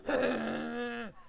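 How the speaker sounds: shaky half-laugh half-cry; nervous laugh-cry. Real, not acted. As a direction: laugh through the panic. Engine straining, near panic.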